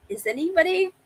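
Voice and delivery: high, anxious pleading call; retold desperate plea for help. Retelling desperate radio calls for rescue.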